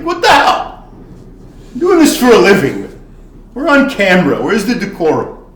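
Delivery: exasperated outrage. Retold or imitated. Bartender's exasperated inner reaction.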